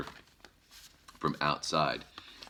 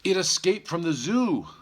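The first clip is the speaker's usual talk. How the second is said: much higher, louder alarmed cry; 13 semitones higher, 7 dB louder than usual. Acted. Mister Blair voiced in story.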